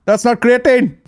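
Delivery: high-pitched, hammed-up panic; mock alarmed shout. Fighter warning mate off the gunpowder, in mock alarm. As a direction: frantically stop him in a put-on accent.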